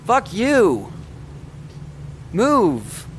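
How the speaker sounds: loud frustrated yell. Real, not acted. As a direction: shout it at him. Yelling at blocking NPC.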